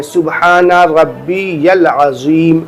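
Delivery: سبحان ربی العظیم drawn out slowly, each word clear; unhurried, measured سبحان ربی العظیم. Shown as a demonstration. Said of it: "آرام آرام سے تین ہی بار پڑھیں".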